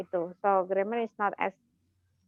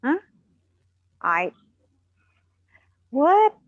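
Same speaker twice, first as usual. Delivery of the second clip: a rising shocked "What?", louder at the end; shocked disbelief; 5 semitones higher, 7 dB louder than usual. Retold or imitated. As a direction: react to a price that is far too high. Shocked at the price.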